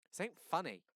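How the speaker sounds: stern. A narrator's reading. Daddy scolding.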